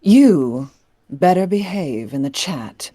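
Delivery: menacing aristocratic vampire lady; Lady Dimitrescu voice. Pretend. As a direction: threaten them as a towering vampire countess. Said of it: "putting on your Lady D voice and threatening chat".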